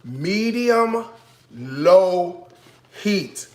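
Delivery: high, spaced-out teacher's chant; call-and-response prompt. Shown as a demonstration. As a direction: separate and stretch each word for repeating. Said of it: "say it with me".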